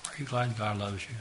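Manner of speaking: normal talking voice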